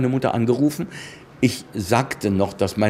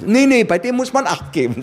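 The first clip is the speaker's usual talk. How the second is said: imitating a piping director, much higher; high-pitched voice; 12 semitones higher, 4 dB louder than usual. Director warns colleagues about the young newcomer, in a high voice. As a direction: shoot the pitch right up on the warning. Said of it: "eine ganz helle, ganz helle Stimme".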